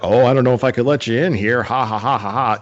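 lightly voiced jokey doorman, ha-ha tacked on; teasing doorman. Doorman ribbing a visitor in a Red Sox hat, teasing. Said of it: "the doorman's like".

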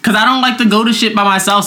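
ordinary talk, nothing marked

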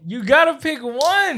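high, loud hyped-up bro; frat bro. Acted. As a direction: push him like a rowdy frat boy. Keeps pressing friend to choose.

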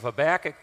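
Habakkuk as huh-BACK-ik; American pronunciation. Shown as a demonstration. Preacher announcing the morning's book, playfully using huh-BACK-ik for Habakkuk. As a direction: say Habakkuk with stressed "BACK" in the middle. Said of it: "they pronounce it in some parts of America".

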